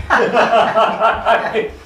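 a burst of high, loud laughter. Real, not acted. Preacher laughs at his own joke.

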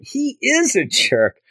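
"he is a jerk" pitched high and drawn out; emphatic verdict. Retold or imitated. Flash confirming what classmates think of Peter, high drawn-out stress on "is". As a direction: punch "is" high and slow like an exclamation point. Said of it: "he's there for the exclamation point".

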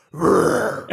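breathy whispered roar; lion roar. Shown as a demonstration. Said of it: "you have to go".

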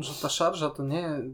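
ordinary talk, nothing marked

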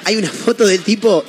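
ordinary talk

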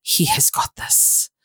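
slow, near-whispered assurance; hushed reassurance. Real, not acted. Speaker telling listeners to rest in Yahweh, hushed. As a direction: let it out slowly, almost all breath.

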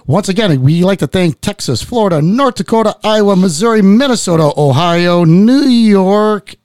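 loud, high rousing list of states; shout-out roll call. Acted. Host thanking listeners state by state, cheering.

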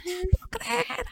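high, slow mock scream, kept soft; retold scream. Relatives inside react to her arrival, imitated soft high scream. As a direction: squeal it softly, imitating an astonished cry from indoors. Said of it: "se oye un grito desde adentro".

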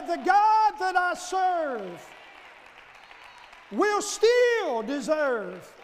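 loud, slow, drawn-out declaration; preaching at full force. Acted. Preacher to congregation, slow full-force proclamation. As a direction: hammer each phrase home.

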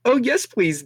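high squeal of delight; high princess voice, thrilled. A narrator's reading. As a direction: squeak it eagerly. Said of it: "Daisy squealed with delight".

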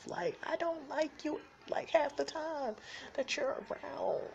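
high, slow whine; high slow whine about a partner he dislikes; high whiny complaint. Real, not acted.

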